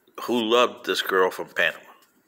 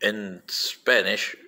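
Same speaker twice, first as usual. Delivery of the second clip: ordinary talk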